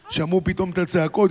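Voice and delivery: ordinary talk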